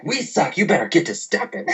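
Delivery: high-pitched retold sass; mock-offended retort; indignant comeback. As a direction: act out telling a heckler off, amused.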